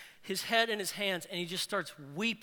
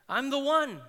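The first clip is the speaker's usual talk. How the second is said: higher, eager cry of a healed man; excited insistence; 6 semitones higher than usual. Acted. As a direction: blurt it out, thrilled to be seen. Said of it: "palpable excitement of this man".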